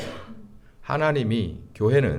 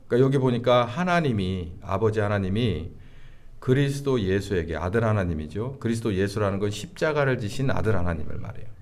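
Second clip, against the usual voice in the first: ordinary delivery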